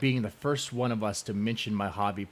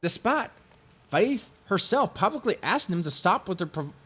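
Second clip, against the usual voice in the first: high, louder sneering mimicry; mocking voice; 11 semitones higher, 4 dB louder than usual. Mocking the rival's complaint in a high sneering voice. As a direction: parrot the opponent with a sneer. Quoting opponent's mocking claims.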